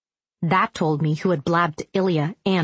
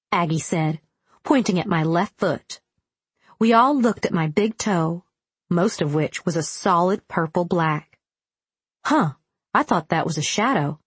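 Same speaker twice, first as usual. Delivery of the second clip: plain reading voice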